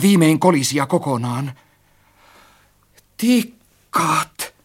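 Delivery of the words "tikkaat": high, breathed-out word; relieved sigh. A narrator's reading. Narrator recognises the ladder, sighing in relief. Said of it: "henkäisin helpottuneena".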